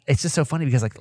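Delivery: ordinary talk, nothing marked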